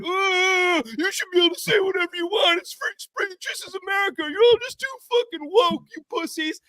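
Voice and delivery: high, shrill-sounding mock of internet trolls at a shout; mocking whiny voice of internet commenters.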